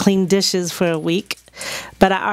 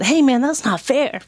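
lightly voiced valley girl, a bit higher; 5 semitones higher than usual.

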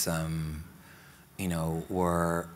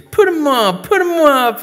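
much higher, loud mock-brave cowardly lion; 17 dB louder than usual. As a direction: puff up like a timid lion playing tough. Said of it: "like the best cowardly lion".

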